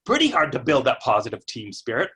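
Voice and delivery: ordinary talk, nothing marked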